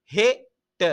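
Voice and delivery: ஹெட்ட split into he, then tta; syllable by syllable. Shown as a demonstration. Teacher modelling pronunciation for pupils, ஹெட்ட in split syllables. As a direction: sound out ஹெட்ட in two separate beats. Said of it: "உச்சரிப்போட தந்திருக்குது பாருங்க".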